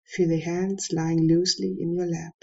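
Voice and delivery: ordinary talk, nothing marked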